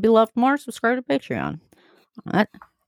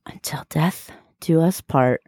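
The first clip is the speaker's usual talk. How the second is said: slow hushed whisper, "do" drawn out; 3 semitones lower, slower than usual. Acted. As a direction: breathe the vow like a secret. Whispered podcast sign-off ritual.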